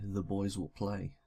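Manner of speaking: usual speaking voice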